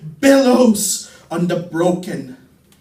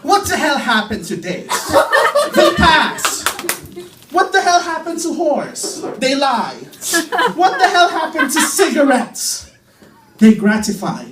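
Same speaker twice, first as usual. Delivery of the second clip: higher, laughing, fast barroom questions; Bukowski-style rant; 6 semitones higher than usual. Acted. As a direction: toss the questions out with a half laugh.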